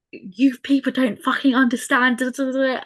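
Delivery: angry message read out. Retold or imitated. Woman reading her angry text to social care, mock-furious. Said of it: "take all the emotion out".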